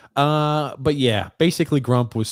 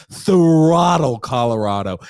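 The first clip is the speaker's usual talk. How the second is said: emphatic quote; 6 dB louder, slower than usual. Retold or imitated. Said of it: "I said".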